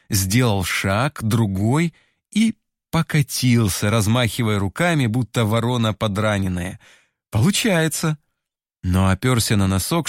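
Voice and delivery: ordinary delivery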